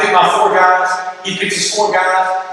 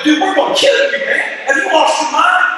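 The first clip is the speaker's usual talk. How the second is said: much higher, incredulous mock threat; 9 semitones higher than usual. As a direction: throw it back in disbelief, voice shooting up. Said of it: "these guys are incredulous".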